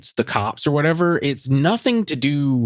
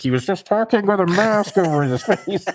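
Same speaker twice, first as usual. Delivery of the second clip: masked-villain impression, raised pitch; Bane; 8 semitones higher than usual. Podcaster mocking the masked character's voice, jokey villain impression. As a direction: imitate someone talking through a mask. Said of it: "it sounded like Bane".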